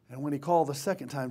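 ordinary talk

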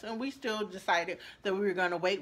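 ordinary talk

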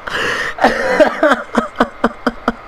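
long wheezing laugh; helpless, breathy laughter; soft, mostly voiceless giggling fit. Real, not acted. Amused after fleeing the game fight.

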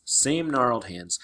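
ordinary delivery

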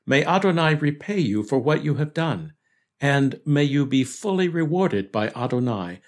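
normal talking voice

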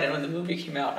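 ordinary talk, nothing marked

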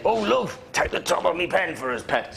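lively Geordie polite request; Geordie accent. Shown as a demonstration. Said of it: "Justin Geordie".

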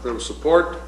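ordinary talk, nothing marked

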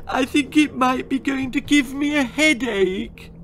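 high tearful whine; milk it like a pouting child; whiny child. Acted. Daughter angling for sympathy, high tearful whine.

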